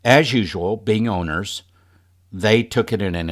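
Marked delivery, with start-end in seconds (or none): none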